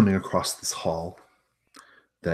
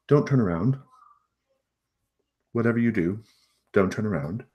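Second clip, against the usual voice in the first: higher urgent warning, eyes past their shoulder; hostess warning; 4 semitones higher than usual. Acted. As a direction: warn them without making a scene. Hostess warns party.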